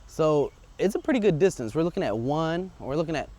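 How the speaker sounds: ordinary talk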